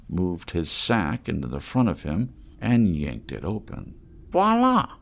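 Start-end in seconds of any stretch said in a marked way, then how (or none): 4.3-4.9 high, loud flourish; showman's exclamation. Acted. Reno presenting his treasure to the mole, high and loud. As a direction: unveil it like a magician. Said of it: "he exclaimed".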